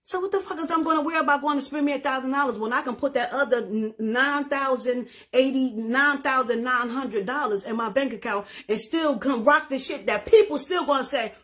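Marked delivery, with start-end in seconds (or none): none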